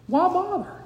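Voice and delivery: high, dismissive shrug of a question; mock skeptic. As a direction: toss it off like a doubter would. Voicing the skeptic's objection.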